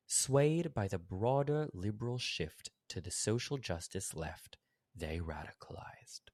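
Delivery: slow, faintly British read of "Swayed by the broader liberal shift" that drops to a whisper on "they radicalized"; hushed mock-British read, "they radicalized" whispered slowly; Andrew Sullivan voice. Host reads Sullivan's line in a slow faint British accent, whispering "they radicalized". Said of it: "Do my best Andrew Sullivan voice".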